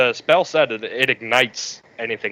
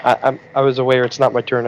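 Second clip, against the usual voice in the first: ordinary delivery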